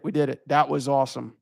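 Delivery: ordinary talk, nothing marked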